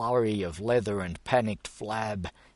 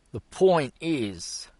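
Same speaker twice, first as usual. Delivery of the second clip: slow, firm and louder; 6 semitones higher, 5 dB louder, slower than usual. A narrator's reading. Gus firmly steering the talk back, slow and firm. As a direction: take the floor firmly. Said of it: "Gus firmly went on".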